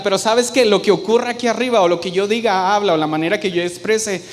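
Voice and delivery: ordinary talk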